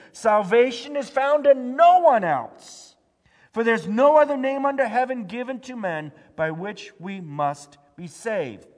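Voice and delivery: loud proclaimed verse. Acted. Pastor proclaiming Peter's words to the church, loud. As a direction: thunder it out like Peter before the council.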